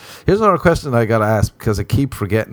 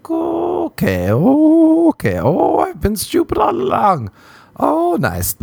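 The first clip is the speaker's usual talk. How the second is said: mock realization; slower than usual.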